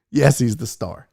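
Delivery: normal talking voice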